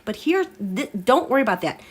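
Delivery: usual speaking voice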